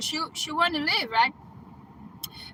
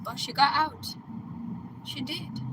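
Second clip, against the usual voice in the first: plain reading voice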